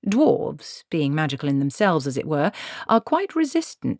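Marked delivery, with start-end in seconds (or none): none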